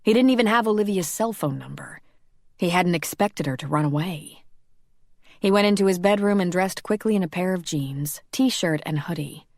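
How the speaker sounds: ordinary delivery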